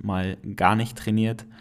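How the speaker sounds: usual speaking voice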